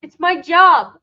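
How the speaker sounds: loud, playful self-defence; mock indignant protest. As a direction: protest loudly in mock outrage. Mock quarrel over being muted.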